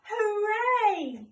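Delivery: high, loud cheer from far away; echo shouting back. A narrator's reading. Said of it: "the Echo shouted back to them".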